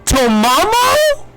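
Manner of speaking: high, loud squeal of delight; fangirl scream. Real, not acted. Thrilled fan screaming at the screen as Tomomo appears. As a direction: shriek with joy at a favorite character. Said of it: "Sorry if I'm screaming".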